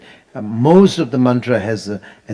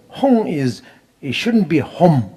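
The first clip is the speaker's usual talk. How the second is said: usual speaking voice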